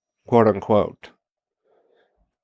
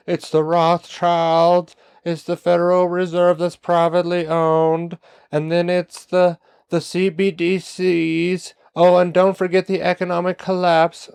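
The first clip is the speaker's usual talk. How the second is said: mocking truther talking points; 8 semitones higher than usual. Voicing preconceived truther talking points.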